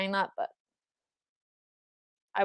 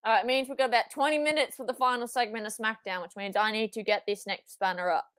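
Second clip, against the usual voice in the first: ordinary talk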